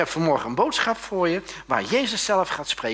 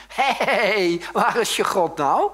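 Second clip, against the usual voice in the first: high, loud drawn-out yell; slow, jeering bellow; Goliath's taunting shout; 7 semitones higher, 8 dB louder, much slower than usual. Acted. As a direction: holler a taunt at the enemy. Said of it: "loopt hij te roepen tegen hun".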